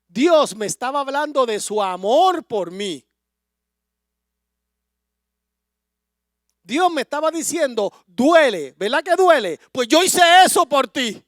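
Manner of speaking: rising into a loud, high preaching climax; growing into impassioned preaching. Real, not acted. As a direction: build the sermon to full force. Preaching at full force, building.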